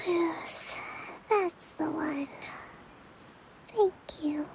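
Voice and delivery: drowsy, breathy murmur; half asleep, barely audible; sleepy whisper. Acted. Kokomi murmuring while dozing off.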